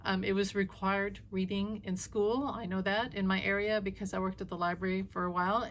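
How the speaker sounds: ordinary delivery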